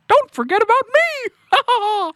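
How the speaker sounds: very high, drawn-out cartoon squeal; slow high-pitched cartoon voice with little laughs; squeaky cartoon character. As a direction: pipe up in a giggly falsetto. Mickey Mouse character butts in.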